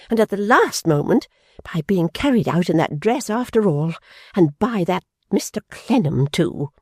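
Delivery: high, fretful, affronted tirade; petulant indignant young lady. A narrator's reading. Fanny scolding Pa about Amy's dress, high and petulant. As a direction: rant like a spoilt offended sister. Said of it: "flushed indignantly".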